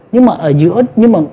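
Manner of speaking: ordinary talk, nothing marked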